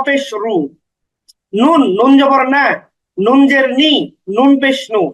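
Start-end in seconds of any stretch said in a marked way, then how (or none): none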